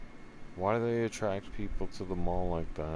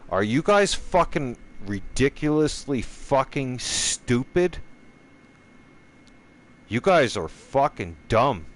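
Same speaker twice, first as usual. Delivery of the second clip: higher, louder, furious shouting; furious yelling; 5 semitones higher, 6 dB louder than usual. Real, not acted. Yelling at his viewers over the fire, furious shouting. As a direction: scream it at the whole world.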